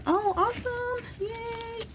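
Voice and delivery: very high, soft squeal of delight. Real, not acted. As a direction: let out a tiny, high-pitched cheer. Delighted at receiving extra gifts.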